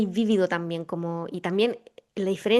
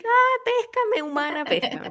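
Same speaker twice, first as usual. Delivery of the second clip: high, drawn-out mock yell; cat crying for attention; 13 semitones higher than usual. Host voices the cat pestering its human, mock yelling. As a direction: imitate a demanding cat with a high, stretched-out cry. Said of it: "gritando así".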